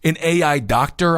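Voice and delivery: normal talking voice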